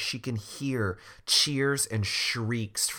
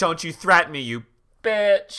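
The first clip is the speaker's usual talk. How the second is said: loud and high, furious; Tamara yelling at the old woman; defiant snap; 8 semitones higher, 6 dB louder than usual. Acted. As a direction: tell off the witch. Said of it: "And Tamara's like".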